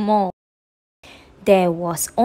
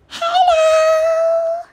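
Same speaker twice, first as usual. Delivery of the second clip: very high, small new-born voice; a tiny figure speaking for the first time; freshly made clay person greeting its maker; little person's first hello. Acted.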